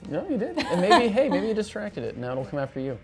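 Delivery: ordinary delivery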